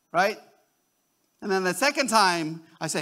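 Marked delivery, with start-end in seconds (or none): none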